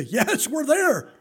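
high, loud, drawn-out mock yell; exasperated parent. Parent answering kids asking are we there yet, exasperated mock shout. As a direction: yell it back slowly, fed up.

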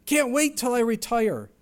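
playing an impatient young person; eager kid voice. Preacher imitating an impatient youngster, eager. Imitating worker longing to retire.

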